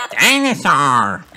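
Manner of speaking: high, slow little piglet shout; mild shout. A narrator's reading. George joining in by the ducks, shouting. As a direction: shout it small and drawn out. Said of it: "shouts George".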